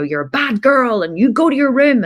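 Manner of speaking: dad ordering his daughter to her room; mock angry dad. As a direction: send her off like a cross dad. Angry dad sending child away.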